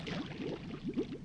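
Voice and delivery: hushed little amused laugh; soft chuckle. Acted. Lamia amused by her pet, soft chuckle.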